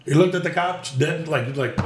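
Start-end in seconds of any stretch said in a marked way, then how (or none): none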